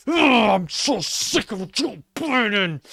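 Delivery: "Oh, I'm so sick of Joe Biden" drawn out slowly in a high, whiny voice; mocking a conservative, slow and whiny; mock conservative whine. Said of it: "That's your average conservative".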